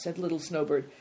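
usual speaking voice

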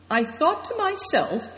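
high, slow, mildly effortful; a touch of labour in slow words; with difficulty. A narrator's reading. Wounded Affliction continues his account, with some difficulty. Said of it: "continued Affliction with difficulty".

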